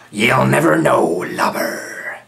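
loud taunting pirate voice; pirate looming over Pat; pirate sneer. A narrator's reading. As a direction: sneer it down at him.

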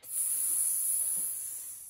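long soft snake hiss; boa constrictor hiss. Shown as a demonstration. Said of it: "Can you hiss like that boa constrictor?".

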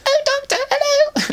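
very high squeaky character voice; Alpha Centauri voice. Retold or imitated. Voicing Alpha Centauri to the Doctor, very high squeak. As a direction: pitch way up into a tiny squeaky voice. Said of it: "I can't do it now because I was younger".

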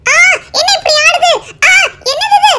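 high, panicked cries for help as the balloon pulls her off; a child swept away, begging someone to save her. Acted. Balloon drags her away, dream. (பலூனில் இழுக்கப்படும் சிறுமியின் பதற்றமான உயர்ந்த குரல்)

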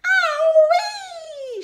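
very high, loud hen shriek; long piercing squawk of shock. A narrator's reading. Hen shocked by a beakful of medicine, shriek. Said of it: "shrieked the hen".

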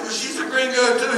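high, loud comic objection; retold indignant squeal; mock protest. Retold protest about wife's accent.

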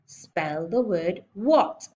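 loud, heavily spaced words; slow, emphatic order. Acted. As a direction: dole out each word to a slow pupil. Headmistress spells out her order impatiently.

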